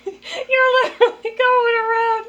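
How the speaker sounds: high, loud laugh-crying. Real, not acted. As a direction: squeal through tears of laughter. Helpless laughter.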